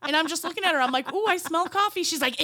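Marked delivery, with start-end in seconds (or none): 1.1-2.0 high, sing-song sniffing hint; mock hopeful hint. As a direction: drop a hint that you smell the coffee, high. Retold hint to worker.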